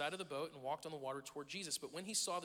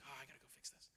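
much softer offhand thought; casual aside; 4 semitones lower, 15 dB quieter, faster than usual. Retold or imitated. Jesus, seeing the struggling disciples, shrugs softly to himself. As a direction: toss it off under your breath. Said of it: "he's like".

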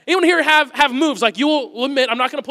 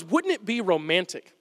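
dreamy and romantic; 5 semitones lower, 6 dB quieter than usual. Retold or imitated. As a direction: imitate a wife dreaming of romance, gently. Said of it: "Megan's mentality was".

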